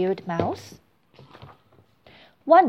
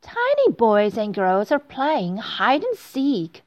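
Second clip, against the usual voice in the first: plain reading voice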